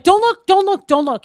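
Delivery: high, loud, rapid-fire pleading; retold alarm, easing on the last repeat; frantic warning. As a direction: act out a panicked boyfriend shielding her view.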